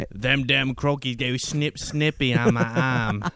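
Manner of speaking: lightly put-on Aussie accent; Australian accent. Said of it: "give us your Australian accent".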